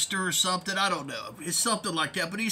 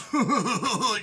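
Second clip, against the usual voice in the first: mock giggle; 2 semitones higher, 4 dB louder than usual. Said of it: "that's how he talks".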